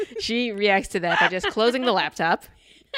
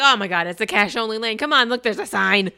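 louder, impatient mock complaint; fed up, pointing out the obvious; exasperated onlooker; 3 semitones higher, 6 dB louder than usual. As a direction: grumble like someone stuck in line. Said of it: "You're just sitting there like".

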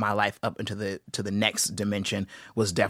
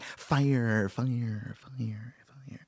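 whispered fire; 5 dB quieter, much slower than usual. Retold or imitated. Mimicking gems dropped.

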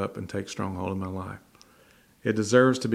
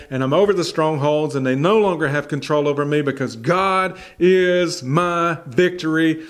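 louder, rising sermon climax; preaching at full force; 4 semitones higher, 4 dB louder than usual. Acted. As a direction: build the crowd up, louder each phrase. Preaches the lesson.

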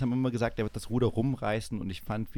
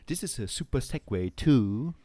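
slow, drawn-out American presenter voice; hammed-up American TV tone; American TV host; slower than usual. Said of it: "im amerikanischen Fernsehen hört man immer". (übertriebener amerikanischer Moderatorton)

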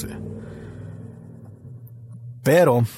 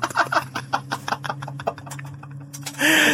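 long breathless high-pitched wheeze; high laugh; 6 dB quieter than usual. Real, not acted. Repeating the joke to his viewers, wheezing high laugh. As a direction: laugh till the voice goes thin.

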